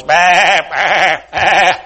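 loud wavering sheep bleats; bleating flock. Acted. All the sheep making noise, bleating. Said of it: "you're making a lot of noise".

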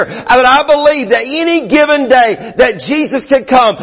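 sermon voice building to Be ready; fervent preaching. Acted. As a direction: hammer the warning home to the church. Preaching at full force.

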